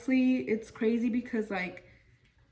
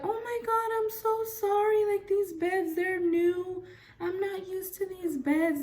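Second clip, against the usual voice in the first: much higher, sing-song apologetic nurse voice; flustered nurse; 10 semitones higher than usual. Retold or imitated. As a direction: squeak out the apology like a panicked nurse. Nurse apologizing after dropping bed.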